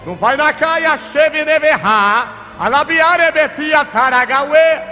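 rapid rhythmic tongues prayer, slowing at the end; speaking in tongues. Real, not acted. Pastor praying in tongues over the congregation, fervent. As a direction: pour out syllables in fervent prayer.

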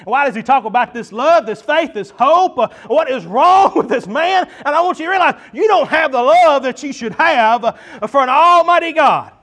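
loud, high, fervent preacher's call; preaching. Acted. Pastor to congregation, preaching loud and fervent. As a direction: pour it out like a revival preacher.

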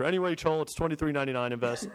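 ordinary talk

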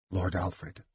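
soft, toneless and drained; a hollow murmur over the dead. Acted. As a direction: say the name with no life left in it. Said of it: "he said tonelessly".

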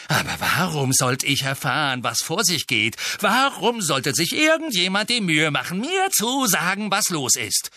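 Harry flares up at friends kept in the dark; angry outburst. A narrator's reading. Harry angrily demands answers from Ron and Hermione.

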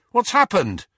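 high, loud worried call. Acted. Harry calls down to Hermione, loud and worried. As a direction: shout the question down the stairs. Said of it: "he called".

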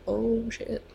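muttered dismay. Retold or imitated. Muttered reaction in story.

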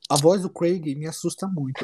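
ordinary talk, nothing marked

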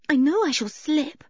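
put-on high, fretful little girl; high-pitched character voice; worried Anne. A narrator's reading. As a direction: fret in a high, anxious voice.

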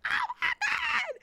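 very high, slow drawn-out squeal; mock fangirl scream. Fake fans as members approach, mock scream. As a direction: stretch it into an imitation fan shriek.

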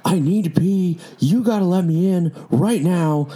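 high, slowed, pushy demand to be let in; angry demand. Retold or imitated. Drunk girl at the locked bar door, angry demand. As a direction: insist furiously that she must pee. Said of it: "getting very angry, like, really upset".

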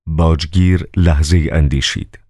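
ordinary delivery

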